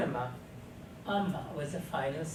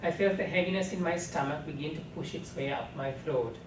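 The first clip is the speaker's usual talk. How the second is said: ordinary delivery, nothing marked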